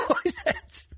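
very high, laughing squeak on the words. Real, not acted. Telling his co-host the punchline, a very high laughing squeak.

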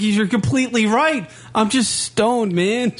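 high-pitched helpless admission; retold pleading. Telling his angry date she is right, high pleading voice. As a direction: concede and plead, acting it out.

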